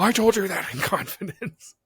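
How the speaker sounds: hammed-up hurt protest; mock offended. Teasing banter about a secret.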